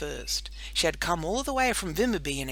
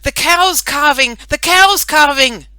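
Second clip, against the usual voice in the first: much higher, much louder slow alarmed call; 10 semitones higher, 18 dB louder than usual. Acted. Farmhand running from the cowshed calling out the news, much louder. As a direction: call it across the farmyard. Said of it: "Alfred came running from the cowshed calling".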